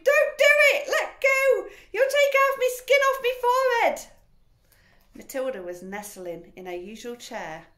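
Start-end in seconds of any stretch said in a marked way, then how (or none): 0.0-4.0 very high, loud panicked scream. A narrator's reading. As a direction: scream at her to stop. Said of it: "He screamed".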